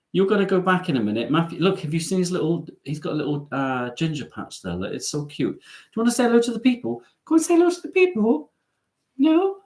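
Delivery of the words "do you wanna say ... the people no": very high, coaxing coo. Real, not acted. Owner asking pet to say hello to viewers, high baby talk.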